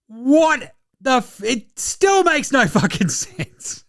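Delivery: disbelieving, laughing it out; laughing disbelief. Real, not acted.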